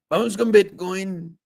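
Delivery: high, slow put-on voice; funny put-on voice. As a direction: stretch it out in a silly high voice. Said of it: "there's something with the voice that you're making".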